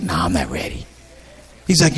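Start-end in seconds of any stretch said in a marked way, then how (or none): 0.0-0.9 much lower, softer refusal; reluctant mumble. Retold or imitated. Young comic dodging the pushy brother, low mumble. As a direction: mumble it away, not ready.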